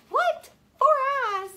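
high, loud mock surprise; mock astonished. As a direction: gasp it out in playful disbelief.

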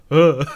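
loud, high deer-in-the-headlights "ugh"; awkward grunt. Retold or imitated. Boy freezing up after botching his chat, awkward grunt. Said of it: "that awkward deer in the headlights".

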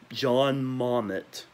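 normal talking voice

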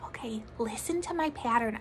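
hushed whispered instruction; soft whisper. Shown as a demonstration. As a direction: whisper so they listen.

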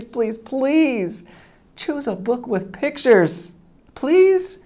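ordinary delivery, nothing marked

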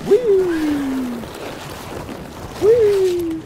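very high, loud whee; gleeful whee. Real, not acted. Gamer zooming down a slope, high loud whee. Sliding down in the game.

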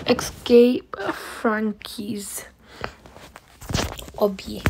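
slow whispered game name; whispering. Real, not acted. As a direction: hush and draw it out.

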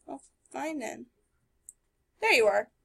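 usual speaking voice

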